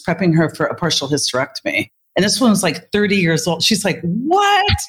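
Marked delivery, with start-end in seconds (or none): 4.0-4.8 high, drawn-out mock shock; shocked patient. Patient hears of the wrong surgery, acted shock. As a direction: stretch it upward in imitated disbelief. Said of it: "the reason for the hysterics".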